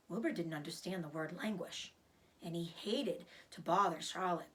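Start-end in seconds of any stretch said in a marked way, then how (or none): none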